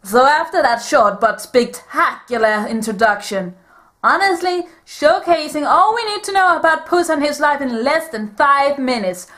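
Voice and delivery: put-on Puss in Boots style Spanish accent. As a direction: heroic swashbuckler in a Spanish accent. Held Puss character voice.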